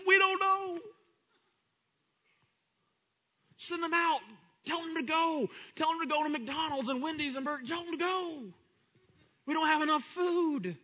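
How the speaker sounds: high, frantic, whiny voice of the disciples; a hammed-up panic of the disciples about food; panicky disciples. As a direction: squeal like the scared disciples.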